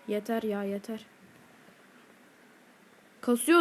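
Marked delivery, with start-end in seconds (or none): 0.1-1.1 softer and lower, defeated; fed up. Real, not acted. Gamer fed up after dying again, fading. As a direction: let it fade out in frustration. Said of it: "konuşamıyorum artık sinirden".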